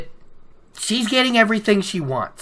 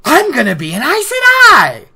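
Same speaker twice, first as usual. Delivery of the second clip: put-on high, loud girlish squeal; giddy excitement; 13 semitones higher, 6 dB louder than usual. Girl shouting her news to Rand, high and giddy. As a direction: burst out with the news. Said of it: "And she's like".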